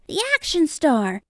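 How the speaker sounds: high, mildly excited cheer; storybook crowd announcing a star; crowd crying out. A narrator's reading. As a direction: call out as an eager crowd. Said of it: "The crowd saw the poster and cried".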